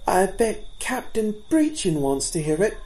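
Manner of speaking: bold fawn. A narrator's reading. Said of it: "said Lychin boldly".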